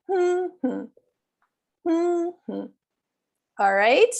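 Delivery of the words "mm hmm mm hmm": two-beat stress hum. Shown as a demonstration. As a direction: hum MM-hmm, first beat high. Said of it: "say the humming".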